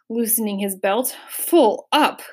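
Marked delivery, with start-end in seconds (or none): 1.3-2.2 loud, higher grunt from a full belly; lightly acted overfull grunt. A narrator's reading. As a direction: grunt it out, stuffed. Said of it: "grunted the undersecretary".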